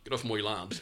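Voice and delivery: failed Texan accent. Shown as a demonstration. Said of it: "my accent sounds very much like".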